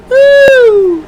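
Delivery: a very high, loud whoop; shrill woo cheer. Real, not acted. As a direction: let out a big celebratory whoop. Cheering after the match result.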